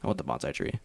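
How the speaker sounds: low, soft, dead-serious demand; deadpan demand. Retold or imitated. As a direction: stare him down and say it flatly. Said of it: "looked him dead in the eyes and said".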